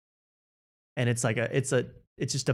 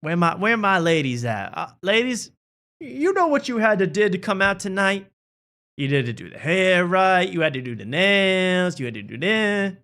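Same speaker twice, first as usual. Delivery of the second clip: lightly voiced hype comic, higher; comedian crowd work; 7 semitones higher than usual. Cat Williams addresses the ladies, lightly voiced hype. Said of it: "he spends the first ten minutes going".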